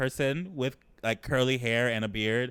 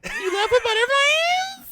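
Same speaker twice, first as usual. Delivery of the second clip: squealing, much higher and louder; shocked disbelief; 5 dB louder than usual. Real, not acted. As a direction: shriek it back in delighted shock. Friend hears about first kiss.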